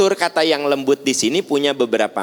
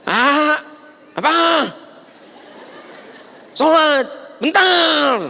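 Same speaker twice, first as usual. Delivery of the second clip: shouted exchange; slower than usual. Retold or imitated. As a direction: yell it back and forth. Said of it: "Ngobrol dari hati kan".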